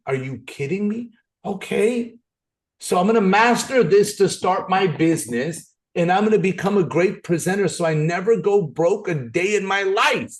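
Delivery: high, loud, excited declaration; fired-up resolve. Real, not acted. Vowing to the audience never to go broke, excited high voice. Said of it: "excited resolve, high and loud".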